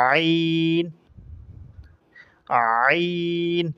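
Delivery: আইন squeezed from the middle of the throat; আইন, চেপে গলা থেকে; Arabic ain from the throat. Shown as a demonstration. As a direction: press আইন tightly from the throat. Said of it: "এভাবে বলতে হবে".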